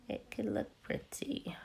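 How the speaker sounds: soft whispered aside. Real, not acted. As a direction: breathe it out softly. Whispered aside about garnish.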